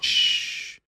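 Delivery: long voiceless hush; shushing. Retold or imitated. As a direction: shush someone, acted out. Acts out shushing the old lady.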